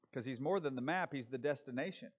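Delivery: normal talking voice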